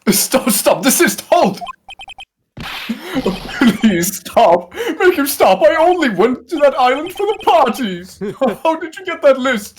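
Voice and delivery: high, loud panicked pleading; panicked shouting. Acted. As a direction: beg at the top of your voice. Accused villain panics under pressure.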